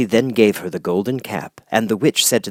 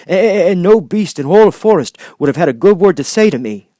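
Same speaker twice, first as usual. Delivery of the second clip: louder, stammering big cat; a shy lion stumbling over his words; timid Lion; 9 semitones higher, 8 dB louder than usual. Acted. Lion telling Glinda of his forest, loud yet stammering.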